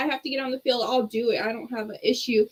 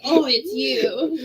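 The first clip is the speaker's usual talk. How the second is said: slow, let-down "Oh, it's you"; mock disappointment, slow; disappointed; 2 semitones higher, 4 dB louder, much slower than usual. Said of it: "Not like".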